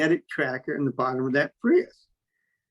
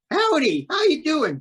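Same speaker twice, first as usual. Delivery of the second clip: much higher, sing-song country greeting; agent's fake-friendly hello, much higher; mock friendly howdy; 13 semitones higher, 4 dB louder than usual. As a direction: play the overly friendly stranger, pitched way up. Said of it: "Just like that".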